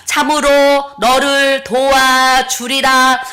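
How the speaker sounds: slow, loud, solemn divine voice; voice of God. Retold or imitated. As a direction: proclaim each line slowly like God speaking. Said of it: "이렇게 음성이 들리는 거예요". (하나님의 음성처럼 느리고 크게 장엄하게)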